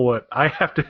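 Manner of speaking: usual speaking voice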